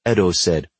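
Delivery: ordinary delivery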